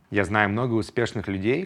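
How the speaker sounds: normal talking voice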